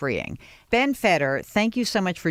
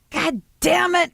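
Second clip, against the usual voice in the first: high, slow, exasperated curse; mock the frantic neighbour swearing at her dog; freaked-out curse; 14 semitones higher, 5 dB louder, slower than usual. Woman losing control of her lunging dog, freaked curse. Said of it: "She's completely freaked".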